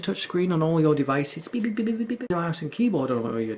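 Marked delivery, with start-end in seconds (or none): 1.5-2.3 quick high-pitched beeping; imitated beeps. Player mocking touch screen devices, imitated electronic beeps. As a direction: mimic a touch screen going beep.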